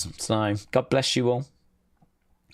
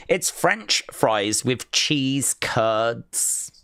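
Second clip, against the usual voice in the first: usual speaking voice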